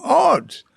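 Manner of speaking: oats said high and bright as odz; a sudden high Oats from the husband; mild imitation of Ketut saying oats; husband's oats. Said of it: "he said".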